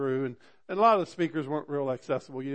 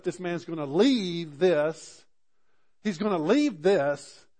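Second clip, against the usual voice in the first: louder, slow, leaning on "leave"; slow emphatic thought; 5 semitones higher, 7 dB louder, slower than usual. Retold or imitated. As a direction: stretch "leave" out in awe. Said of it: "I thought to myself".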